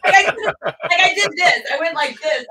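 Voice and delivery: high, loud, laughing through it; can't stop laughing while showing it. Real, not acted. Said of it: "laughing re-enacting".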